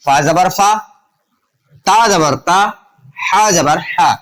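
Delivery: measured "فا زبر فا", a pause after each letter's turn; name each letter and mark, landing on a long aa as in "فا"; spelling-out drill. Shown as a demonstration. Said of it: "زبر کے ساتھ زبر کی آواز نکلے گی".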